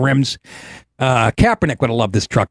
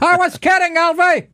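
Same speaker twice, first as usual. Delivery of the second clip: much higher Scarface-style line. Retold or imitated. Quoting Scarface to cohost, high gangster voice. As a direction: do Tony Montana pleading. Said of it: "That's Scarface".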